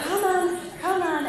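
high, loud coaxing call; calling to goats. Retold or imitated. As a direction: call out to stubborn animals. Calling goats again, reenacted.

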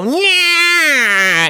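put-on high shrill baby cry; baby wail. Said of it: "listening to a baby go".

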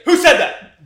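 high, very loud hammed-up outrage; mock enraged shout. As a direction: explode at an unseen heckler, hammed up. Acts out enraged singer.

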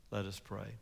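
usual speaking voice

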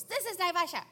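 loud, high, annoyed operator voice; irritated operator picking up at last; angry operator. Retold or imitated. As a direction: snap at the caller as a fed-up operator. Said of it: "this very angry voice".

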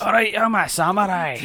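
hammy fake Japanese accent, really Scottish; a terrible put-on samurai voice; bad Japanese accent. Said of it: "a terrible Japanese".

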